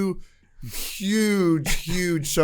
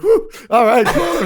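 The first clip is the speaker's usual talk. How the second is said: higher, a breathless laugh of relief; 9 semitones higher, 5 dB louder, slower than usual. Real, not acted. Caught the thrown iPad and escaping, laughing with relief. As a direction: let the relief spill out as a laugh.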